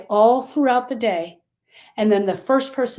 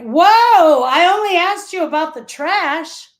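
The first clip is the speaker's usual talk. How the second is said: high, loud startled whoa; taken-aback husband, voice jumping up; 8 semitones higher, 8 dB louder than usual. Retold or imitated. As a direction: recoil like he just got yelled at. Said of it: "he's like".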